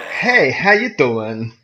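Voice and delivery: slow, drawn-out mock Abyssal greeting; greeting in a hammed-up Abyssal accent. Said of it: "can you say it in an abyssal accent?".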